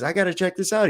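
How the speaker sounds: itching to go now; eager customer. Acted. Customer rushes off to find the genie. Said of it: "he says".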